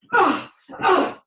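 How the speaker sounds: repeated loud, high bursts of effort; explosive, wordless venting; angry grunts. Shown as a demonstration. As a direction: pound out the anger in short cries. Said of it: "put the anger into these pillows".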